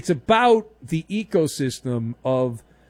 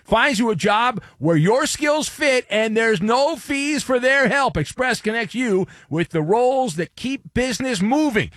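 upbeat, louder sponsor read; 5 semitones higher, 3 dB louder than usual. Acted. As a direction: sell the job service, punchy and bright. Host reads an ad.

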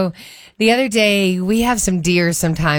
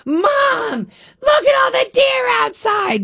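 put-on very high, slow, loud child's call; mock-shout like an excited kid. Imitating daughter shouting to mom about deer, very high loud call. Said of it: "didn't dawn on her to be quiet".

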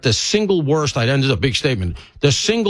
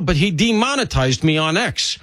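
ordinary delivery

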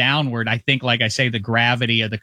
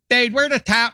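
put-on Pittsburgh accent; 10 semitones higher than usual. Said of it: "I'm doing a Pittsburgh accent".

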